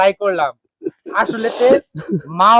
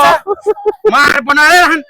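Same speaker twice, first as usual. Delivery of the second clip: loud, raised-pitch scolding; heated, forceful telling-off; shouted threat; 5 semitones higher, 6 dB louder than usual. Acted. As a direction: shout it as an angry demand.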